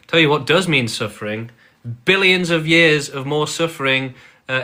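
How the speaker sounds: usual speaking voice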